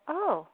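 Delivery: "Oh?" with a sharp rising question tune. Shown as a demonstration. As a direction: ask it: "Oh?" rising high. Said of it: "I can be asking a question".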